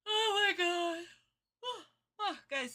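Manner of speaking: usual speaking voice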